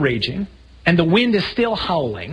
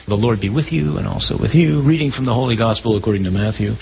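bland reading; 6 semitones lower than usual. Retold or imitated. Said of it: "how blandly we read the Word".

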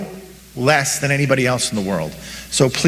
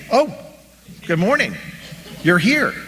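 higher-pitched, acted surprised greeting; pleasantly surprised; 7 semitones higher than usual. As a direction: play waking up to an unexpected guest. Acts waking to find Jesus there.

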